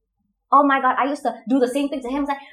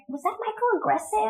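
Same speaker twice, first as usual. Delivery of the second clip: worried realisation; 10 semitones higher, 5 dB quieter than usual. Retold or imitated. Retells later worried realisation.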